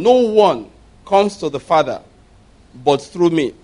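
proclaiming scripture to the congregation; scripture reading. Acted. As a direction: read the verses out with weight. Pastor reads verse six.

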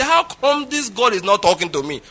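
acted exasperated protest; indignant complaint. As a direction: complain like a put-out believer. Acted complaint of frustrated believers.

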